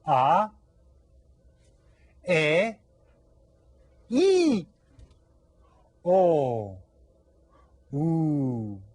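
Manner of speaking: A, E, I, O, U as long, separate pure Spanish vowels; Spanish vowel drill. Shown as a demonstration. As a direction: say A, E, I, O, U one by one for students to repeat. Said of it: "say them with me here".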